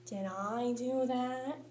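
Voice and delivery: slow, soft nerdy sitcom whine; Steve Urkel. Retold or imitated. Comic wondering if he caused it, slow Urkel voice. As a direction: drawl it like the sitcom nerd. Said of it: "Steve Urkel".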